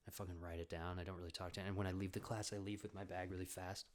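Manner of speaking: ordinary delivery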